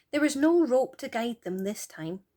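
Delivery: usual speaking voice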